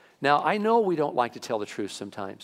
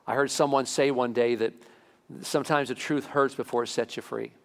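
usual speaking voice

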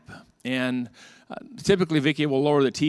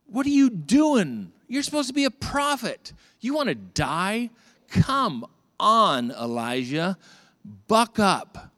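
high-pitched, incredulous dressing-down; mock scolding lecture; 7 semitones higher, slower than usual. God lecturing worn-out Elijah, acted exasperated scolding. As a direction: scold him in exaggerated disbelief, slow and punchy.

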